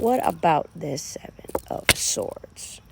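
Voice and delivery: slow secretive whisper. Real, not acted. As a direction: lean in and whisper it slowly. Slips into a whisper.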